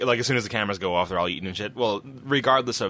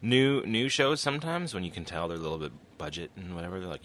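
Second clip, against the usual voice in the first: normal talking voice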